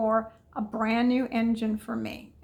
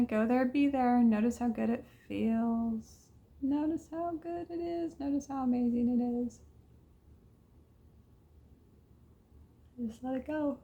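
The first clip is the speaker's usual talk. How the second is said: guided meditation. Acted. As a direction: lead them gently into a good memory. Guides back into good memory.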